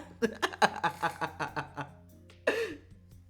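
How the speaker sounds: long high laughing fit; real laughter. Real, not acted. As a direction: crack up helplessly. Cracks up at the comment.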